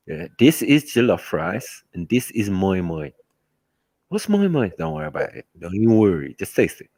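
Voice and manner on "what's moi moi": curious friend. Retold or imitated. Friend asks about the dish.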